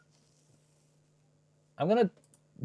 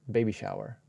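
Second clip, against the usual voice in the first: ordinary talk, nothing marked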